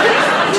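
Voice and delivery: sudden high laugh; high giggle. Real, not acted. Contestant laughs at own remark.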